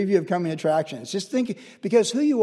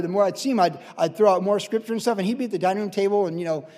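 ordinary delivery

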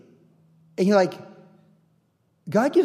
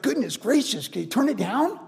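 higher, lightly hushed grumble of disbelief; mock complaint; 4 semitones higher, 4 dB quieter than usual. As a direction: play a bothered neighbor. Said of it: "They're like".

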